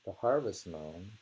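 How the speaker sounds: usual speaking voice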